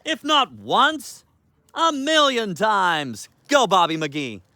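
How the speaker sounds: high, slow teasing reproach; mock scolding sing-song. Real, not acted. Owner to dog John, high slow mock scolding.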